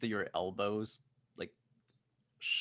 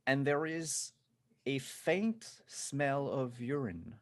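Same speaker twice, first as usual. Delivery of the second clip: higher, very slow, savoured narration; drawn-out comic reveal; 6 semitones higher, slower than usual. A narrator's reading. Game master revealing the executive's fear, drawn-out comic reveal. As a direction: stretch it out delicately, word by word.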